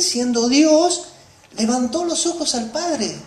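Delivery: normal talking voice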